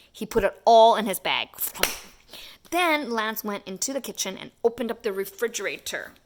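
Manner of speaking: ordinary delivery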